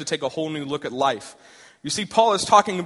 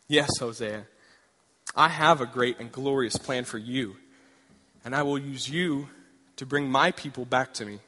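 lower, softer, calm divine voice; voice of God; 4 semitones lower, 4 dB quieter than usual. Acted. God answering eager Hosea, low and calm. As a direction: speak as God, low and steady.

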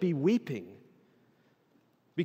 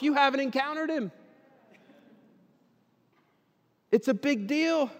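high, loud urgent exhortation; full-force preaching; 10 semitones higher, 6 dB louder than usual. Real, not acted. Preacher urging those who met the risen Lord, full-force preaching. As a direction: drive it home to the congregation, high and forceful.

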